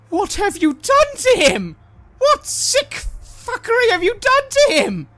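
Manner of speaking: high, loud, horrified demand; outraged woman yelling accusation; furious shout. Acted. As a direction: scream it at him in disbelief. NPC confronts player about soul.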